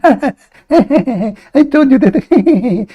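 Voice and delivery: high, drawn-out laughing mimicry of the caller; mocking laughing quote. Host mocks the caller's laugh with drawn-out laughing. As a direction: stretch it out through mock laughter to ridicule him.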